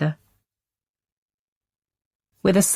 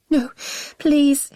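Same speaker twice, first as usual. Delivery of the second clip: desperate plea; 10 semitones higher than usual. Acted. Wife begging while choked.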